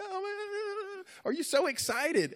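very high, loud squeal, imitating her; squealy mock excitement; gushing excited girl. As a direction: mimic an overexcited girl at top pitch. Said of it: "she was so happy and so smiley".

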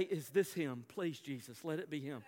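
low, soft retold prayer; hushed pleading. Onlookers praying it is the lost boy, hushed pleading. Anxious onlookers pray over found child.

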